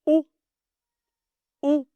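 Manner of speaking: "O" said as a clear round oh; sound demo. Shown as a demonstration. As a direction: model the sound "O" for the class. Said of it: "se prononce".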